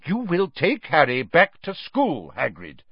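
firm order, dropping on the name; Dumbledore. A narrator's reading. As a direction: insist firmly, allowing no argument. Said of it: "Dumbledore repeated firmly".

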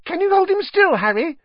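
put-on high, eager boy's voice; breathless young fan, all questions; excited Colin. Colin asking to take a photo.

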